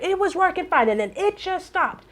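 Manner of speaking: high-pitched imitation of a worked-up customer; anxious caller. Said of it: "I hear the anxiety".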